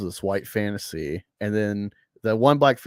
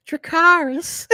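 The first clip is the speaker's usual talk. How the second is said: very high, slow, laughing Dracarys; SNL Dracarys; 5 dB louder, slower than usual. Retold or imitated. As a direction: squeal the dragon command. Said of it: "that clip from SNL".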